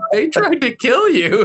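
a hint of a horrified cat; shocked cat. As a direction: tell him he was nearly killed. Cats react to the chihuahua.

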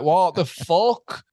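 slow, high-pitched incredulity; drawn-out, rising mock disbelief; baffled disbelief. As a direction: stretch it out in bafflement. Acting out baffled reaction to warning.

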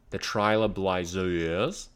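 slow Aussie drawl on "Trailer Blazers"; Australian accent. Pretend. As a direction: stretch the show name out Aussie-style. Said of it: "very Australian".

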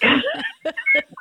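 a high, helpless burst of giggling; high giggle. Retold or imitated. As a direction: crack up like a kid at a rude word. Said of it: "couldn't stop laughing".

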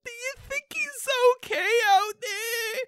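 high, quavering worried grandma; old grandma voice. Acted. Grandma worrying about Bigfoot in the cold, high and quavering. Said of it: "I love imagining there's this, like, grandma writing on Quora".

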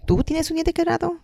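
high rising question; questioning tune. Shown as a demonstration. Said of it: "for clarity, I will say things like".

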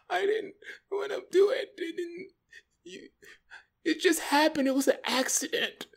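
put-on high, tearful girlfriend falsetto; mock crying. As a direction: imitate a girlfriend whimpering through tears. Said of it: "She starts crying. She's crying".

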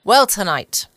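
usual speaking voice

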